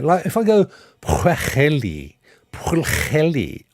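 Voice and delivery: Pwheli with a rasping xh in the middle; testing Welsh pronunciation. Shown as a demonstration. Streamer asking friend if Pwheli is close. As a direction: try Pwheli twice, unsure. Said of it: "am I anywhere?".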